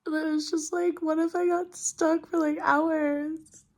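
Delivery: tearful, scared and overwhelmed; crying. Real, not acted. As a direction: struggle to get the words out through tears. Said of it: "I don't know why I'm so emotional".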